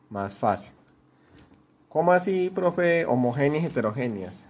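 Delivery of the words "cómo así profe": higher voice of a confused pupil asking; puzzled student. Retold or imitated. Teacher voicing a student asking the profe, lightly puzzled. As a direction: lightly play the student who doesn't get it.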